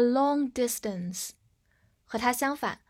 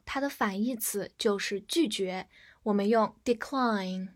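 usual speaking voice

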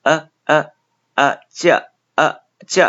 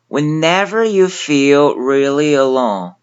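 slow, careful English model line; slow demo reading; 4 semitones higher, 3 dB louder than usual. Shown as a demonstration. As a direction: read it out slowly for learners. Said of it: "其中最难的一个小部分".

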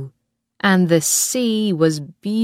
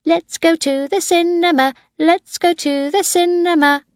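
put-on child shouting; 12 semitones higher than usual. A narrator's reading. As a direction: chant it like an eager boy of five. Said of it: "Tim began to shout".

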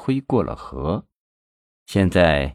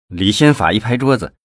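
ordinary delivery

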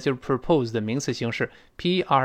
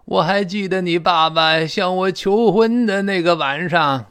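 higher-pitched, fond mother recalling the night; mom reminiscing; 4 semitones higher, 4 dB louder than usual. Acted. Mother telling her child of dad's proposal, reminiscing. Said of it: "这可能妈妈说的".

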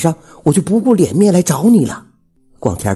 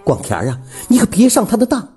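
high-pitched, vehement warning; resentful; 8 semitones higher than usual. A narrator's reading. Widow warning him off her rival, resentfully. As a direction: spit out the grudge, then press him. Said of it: "虎子妈喘着粗气，狠狠地说".

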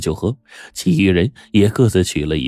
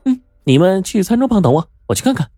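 put-on much higher, eager girl's voice; eager volunteering; 13 semitones higher, faster than usual. Ellie volunteers to check the kitchen for everyone, eager and high. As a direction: jump in keen to help. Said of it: "艾莉自告奋勇地对着众人说".

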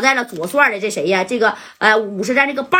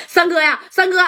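calling out; 8 semitones higher than usual. Retold or imitated. Calling out to his brother.

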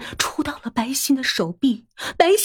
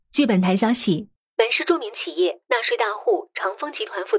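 measured, lower news-anchor read; news report; 3 semitones lower, 4 dB quieter than usual. A narrator's reading. A TV news anchor reports the scandal, measured.

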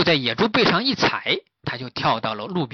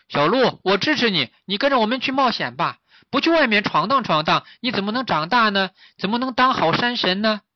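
higher, lively piglet voice; rally the fawn toward adventure; supportive piglet; 8 semitones higher, 5 dB louder than usual. Acted. (小猪高声鼓动)

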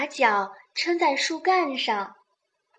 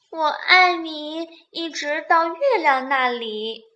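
sleepy little rabbit, higher voice; 小兔子; 5 semitones higher, 3 dB louder than usual. A narrator's reading. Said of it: "小兔子闭上了眼睛". (困倦的小兔子，音调偏高)

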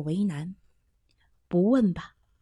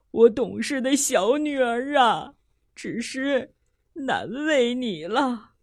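tearful; 2 semitones higher, slower than usual. Acted. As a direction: let the thanks catch as tears come. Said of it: "不禁泪流满面".

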